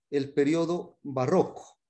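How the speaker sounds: ordinary talk, nothing marked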